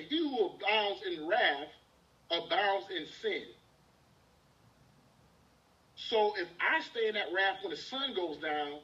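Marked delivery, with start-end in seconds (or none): 0.0-3.5 slow quoting. Shown as a demonstration. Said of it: "It say".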